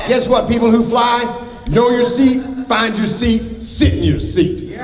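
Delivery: high, exasperated flight attendant lecture; editorializing rant. Acted. Flight attendant scolding slow passengers, exasperated. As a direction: scold the passengers who don't know their seat. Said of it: "me editorializing for just a moment".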